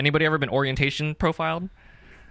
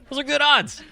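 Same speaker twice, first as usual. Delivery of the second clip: much higher, emphatic repeat; high-pitched insistence; 11 semitones higher than usual. Real, not acted.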